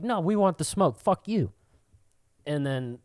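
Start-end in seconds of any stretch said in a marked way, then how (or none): 0.0-1.5 higher, goading the rival fans; cocky taunt. Retold or imitated. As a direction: bring it on, playful provocation. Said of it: "I'm just like".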